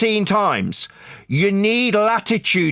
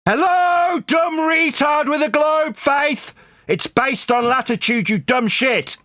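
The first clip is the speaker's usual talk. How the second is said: higher-pitched exasperated shout, hello hurled out first; angry outburst; 7 semitones higher than usual. Real, not acted. Flat-earther berating his globe opponent, angry outburst. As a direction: yell insults in real exasperation. Exasperated insult in heated argument.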